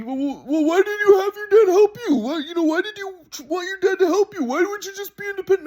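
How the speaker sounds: high mocking grandpa voice; imitating grandpa. Grandson mimics grandpa scolding, mocking high voice. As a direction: nag like the grandpa did.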